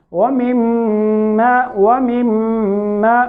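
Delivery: long nasal hum on the doubled m of وَمِمَّا; ghunna. Shown as a demonstration. Teacher demonstrating meem mushaddad, held ghunna. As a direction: recite وَمِمَّا slowly, holding the mm through the nose. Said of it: "میم دیکھیں یہ مشدد ہے تو یہاں پہ گنہ کرنا ہے".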